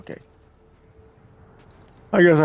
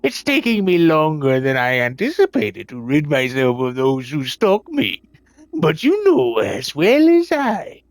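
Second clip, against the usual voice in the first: put-on pirate accent. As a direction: read the captain's letter like a theatrical old pirate. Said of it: "We get to put on my pirate accent again".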